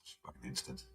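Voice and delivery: soft whisper, savoring a sip; whispered aside. Real, not acted. Tasting his coffee after the call, in a contented whisper. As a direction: breathe it out to yourself.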